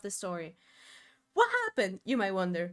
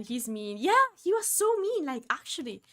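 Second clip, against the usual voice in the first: ordinary talk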